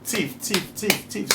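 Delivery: high-pitched mock cry of thief; imitated alarm cry, high-pitched; mock screaming. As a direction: call out for help, high-pitched and half-acted. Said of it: "started screaming and crying out".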